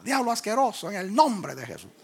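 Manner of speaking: high-pitched mimicry of preachers casting out the devil; imitated rebuke. Preacher mimicking how others insulted the devil, high mock rebuke. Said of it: "le decían asqueroso al diablo".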